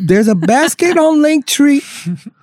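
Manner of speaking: high, slow Southern preacher voice. Acted. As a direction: drawl it like a TV preacher. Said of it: "my Southern preacher voice".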